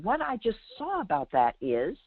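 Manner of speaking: ordinary delivery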